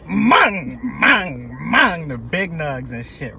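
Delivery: loud, high drawn-out mang; mock dealer. Mocking the dealer handing over big nugs. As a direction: ham up the dealer's sales pitch. Mimicking the dealer's sales pitch.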